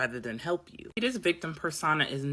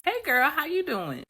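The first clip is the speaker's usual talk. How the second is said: put-on much higher, bright hey girl; bubbly greeting; 12 semitones higher, 3 dB louder than usual. Greeting the friend again, high and bubbly. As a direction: sing it out to an old friend. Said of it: "here's my response".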